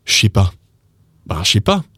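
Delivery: "je sais pas" as "shay pa", for repeating; casual model of "shay pa"; slang contraction. Shown as a demonstration. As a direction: merge "je sais" into "sh". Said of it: "So repeat with me".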